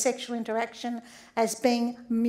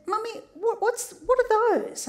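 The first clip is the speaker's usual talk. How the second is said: put-on high, curious child's voice; a small boy puzzled by something new; little boy asking; 9 semitones higher than usual. Young son asking his mother, in a high curious child voice. Son asks about the condoms.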